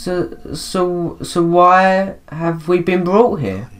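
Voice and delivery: high, slowed, stuttering question; frightened stammer. Acted. Character asking why they were brought here, frightened stammer.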